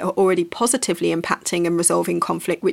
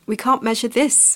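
ordinary delivery

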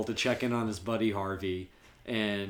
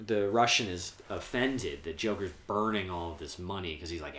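usual speaking voice

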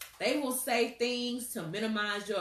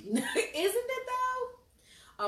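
laughing; 13 semitones higher, much slower than usual. Real, not acted. Laughing agreement.

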